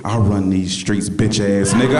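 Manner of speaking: low, slow menacing growl; tough church mother. Retold or imitated. Church mother whispering threat in his ear, low menace. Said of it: "She said".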